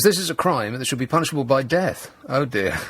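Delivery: ordinary delivery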